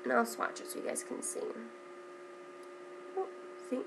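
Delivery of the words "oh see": ordinary talk, nothing marked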